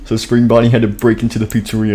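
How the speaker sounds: usual speaking voice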